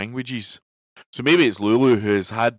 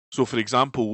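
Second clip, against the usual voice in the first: ordinary delivery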